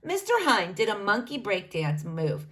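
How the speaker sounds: ordinary delivery, nothing marked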